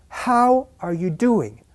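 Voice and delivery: high, slow, with "how" and "doing" stressed; slow demo with beats on "how" and "doing"; rhythmic stress. Shown as a demonstration. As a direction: hit "how" and "do", keep "are you" light. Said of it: "The resulting rhythm is".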